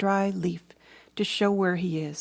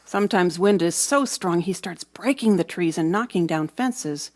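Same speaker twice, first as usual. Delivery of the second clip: usual speaking voice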